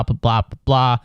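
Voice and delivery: ordinary delivery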